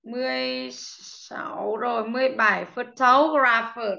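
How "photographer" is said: Photographer said slowly, stress on the second syllable; teacher demo of Photographer with clear stress. Shown as a demonstration. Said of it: "nó rơi vào âm thâu".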